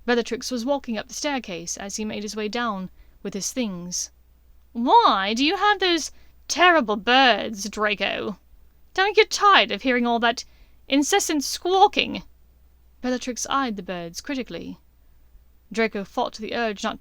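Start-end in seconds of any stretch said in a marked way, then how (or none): 4.7-12.2 disdainful witch. A narrator's reading. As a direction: sneer at the birds. Bellatrix sneering at birds.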